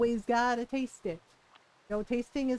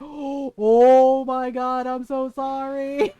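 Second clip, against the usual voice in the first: louder, drawn-out slow apology; shocked apology; 9 dB louder, much slower than usual. Retold or imitated. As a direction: gasp then slowly stretch it out. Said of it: "and she went, gasps".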